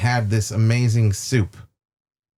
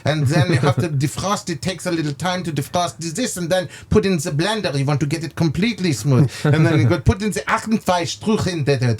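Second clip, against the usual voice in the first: put-on light German accent, pitched a bit higher; lightly put-on German accent, chef rattling on; 4 semitones higher than usual. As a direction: imitate the chef's German accent while he explains. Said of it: "How do you like his, uh, little, uh, accent?".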